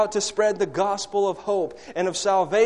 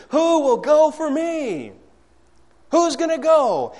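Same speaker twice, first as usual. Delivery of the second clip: God's voice calling out, higher and louder; crying out; 9 semitones higher, 6 dB louder, slower than usual. Retold or imitated. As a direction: call out to the land, pleading. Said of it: "He's crying out".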